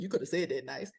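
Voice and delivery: soft, low, prim mock grievance; mock prissy complaint. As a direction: whine it primly under the breath. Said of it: "You can be like".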